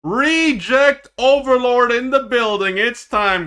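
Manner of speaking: slow, loud exclamation of real excitement; hyped. Real, not acted. Streamer hyping his team to viewers, loud and excited.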